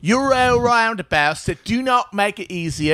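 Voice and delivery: put-on British complaining; mock British accent.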